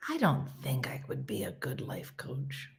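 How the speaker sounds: very soft, near whisper; hushed admission. Real, not acted. Admitting doubts about being a life coach, hushed. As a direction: confide it under the breath.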